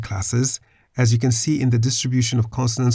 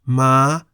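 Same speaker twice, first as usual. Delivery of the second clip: "ma" climbing to high pitch; 2 semitones higher, 3 dB louder than usual. Shown as a demonstration. Teacher models the word meaning horse, high tone. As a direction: lift "ma" up high. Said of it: "the syllable now has a high tone".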